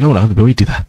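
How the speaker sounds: normal talking voice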